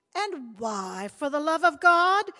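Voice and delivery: loud, slow exasperated demand; a mother demanding answers. A narrator's reading.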